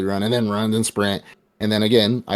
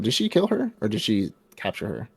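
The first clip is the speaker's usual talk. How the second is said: ordinary delivery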